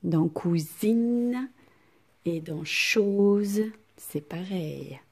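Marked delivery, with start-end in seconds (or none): 0.3-1.5 cousine with a long buzzed "zzz" for the s; stretched Z sound. Shown as a demonstration. Teacher shows pupils the sound inside a word, Z drawn out. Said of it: "elle va faire Z". 2.6-3.6 chose with a long buzzed "zzz" for the s; stretched Z sound. Shown as a demonstration. Teacher gives pupils a second example, Z drawn out. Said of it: "elle va faire Z".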